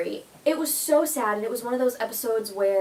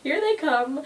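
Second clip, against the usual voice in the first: mock teary; 9 semitones higher, 4 dB louder than usual. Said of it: "And I'm like".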